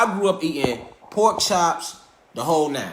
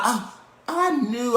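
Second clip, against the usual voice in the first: mocking know-it-all; 6 semitones higher, slower than usual. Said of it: "Let's not".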